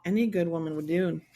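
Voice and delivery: ordinary talk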